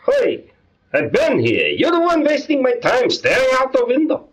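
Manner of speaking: high, irritated shopkeeper voice; grumpy dry cleaner. Acted. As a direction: snap at the customer.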